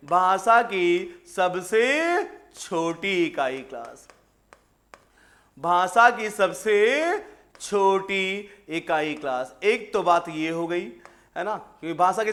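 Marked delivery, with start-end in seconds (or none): 0.1-4.1 very slow, each word stretched; slow dictation. Shown as a demonstration. Teacher dictating a definition to students, very slowly. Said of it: "क्या बोलोगे?". 5.6-9.6 very slow, words spaced apart; slow dictation. Shown as a demonstration. Teacher repeating the definition for students, very slowly. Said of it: "क्या बोलोगे?".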